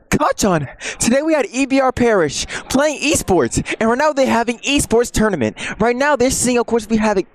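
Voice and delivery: put-on high, earnest anime boy voice; squeaky eager anime hero; Deku voice. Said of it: "I can still like Deku".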